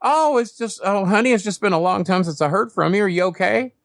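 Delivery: high, sugary phone voice with a laugh; ex-girlfriend Rhonda. Retold or imitated. Ex Rhonda calling him on the phone, high and sugary. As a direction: play the ex calling sweetly after months. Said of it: "It's Rhonda".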